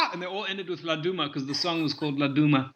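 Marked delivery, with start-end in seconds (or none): none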